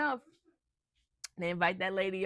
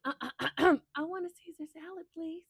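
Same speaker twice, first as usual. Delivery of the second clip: squeaky little voice; 10 semitones higher than usual. Retold or imitated. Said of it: "he's like".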